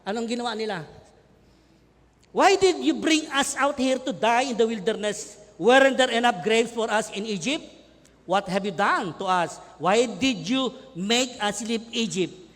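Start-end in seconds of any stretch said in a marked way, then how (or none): 2.3-12.5 loud, slow accusing shout; angry complaint. Acted. Israelites shouting blame at Moses, loud slow anger. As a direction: hurl each question at Moses. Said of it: "And they said to Moses".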